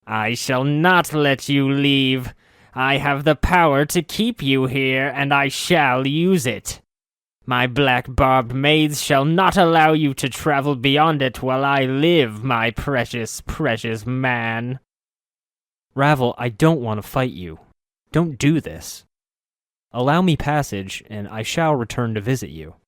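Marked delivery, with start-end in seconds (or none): 0.1-14.8 high, menacing witch voice, slowing at the end; a witch refusing to let him go; possessive witch. Acted. Witch Ravel threatens possessively. 15.9-21.0 earnest, desperate plea; begging her to let him pass; pleading. Acted. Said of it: "My voice became pleading, desperate".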